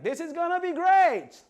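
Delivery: loud, high-pitched excited shout; whooping with anticipation; excited cheer. Acted. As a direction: shout it like a thrilled road-tripper. Acted excited crowd leaving Egypt.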